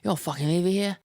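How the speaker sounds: put-on retelling a Liverpool stranger's threat in his accent. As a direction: do the Liverpool man ordering him off in his accent. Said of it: "Liverpool accent".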